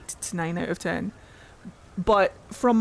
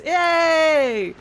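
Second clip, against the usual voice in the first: higher, louder gleeful yay; excited cheer; 7 semitones higher, 6 dB louder than usual. Real, not acted. Reacting to the game to viewers, an excited cheer. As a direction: cheer like something just went right.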